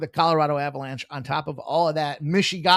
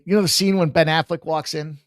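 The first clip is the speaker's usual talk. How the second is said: ordinary talk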